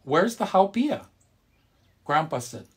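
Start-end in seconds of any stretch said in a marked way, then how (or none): 0.0-1.1 high, louder grandma asking. A narrator's reading. Grandma asks grandpa where the food is, high and eager. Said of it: "Grandma asked".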